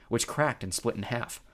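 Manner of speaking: usual speaking voice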